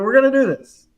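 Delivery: high, loud doubting echo; incredulous cousin. Retold or imitated. Doubting cousin questioning the job.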